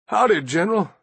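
a hint of a sick old man; weak drawled greeting; feeble old soldier. A narrator's reading.

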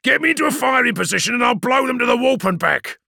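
fast, high, aggressive battle voice; fierce, rapid gunner's boast; snarled demand. A narrator's reading. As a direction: snarl it out in a rush. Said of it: "snarled Vimana Cybus".

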